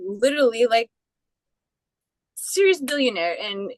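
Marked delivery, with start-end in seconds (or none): none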